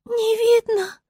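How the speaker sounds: high star voice, echoing the hare; questioning star. A narrator's reading. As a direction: ask it back in a high voice. Star echoes the hare, reviving. (высокий переспрашивающий голос звезды)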